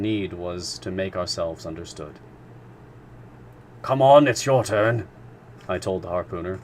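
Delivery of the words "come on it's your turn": urging. A narrator's reading. Narrator urging the harpooner.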